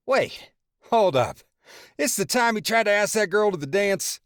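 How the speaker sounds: brother who remembers the best bit; laughing brother. A narrator's reading.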